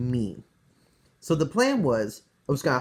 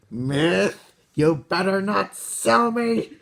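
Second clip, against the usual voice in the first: mock-angry warning, higher and drawn out; worried and mad; 4 semitones higher, slower than usual. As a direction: warn him off in a higher, indignant voice. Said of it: "getting a little worried and mad".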